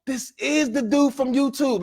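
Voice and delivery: high, slow, amazed; stunned disbelief. Retold or imitated. Realising the stranger is his YouTube idol, stunned. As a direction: drag it out, mind blown. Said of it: "I'm like".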